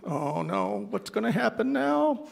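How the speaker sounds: mock worry, pitch climbing to the end; apprehensive. Woman eyeing the approaching pastor, mildly alarmed. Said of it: "she was looking at me like".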